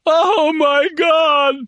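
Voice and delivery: high, slow wail of pain; drawn-out pained scream. Acted. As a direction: stretch it out in agony. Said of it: "screamed Lampert".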